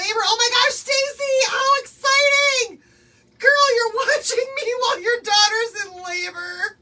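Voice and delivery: high, loud squeal of excitement; thrilled. Real, not acted. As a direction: shriek with joy at the news. Viewer's daughter in labor.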